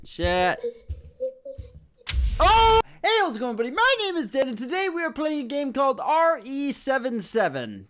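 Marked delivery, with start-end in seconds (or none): 2.4-7.9 high-energy gamer channel intro; show-opening greeting. Acted. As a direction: launch the show with a big drawn "Oh!".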